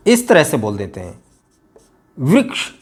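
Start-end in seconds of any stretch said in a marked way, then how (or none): 2.2-2.7 वृक्ष with short vocalic ृ; correct pronunciation. Shown as a demonstration. Teacher repeating the correct वृक्ष for practice. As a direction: clip वृक्ष, no added ई sound. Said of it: "ई की आवाज़ नहीं आनी चाहिए".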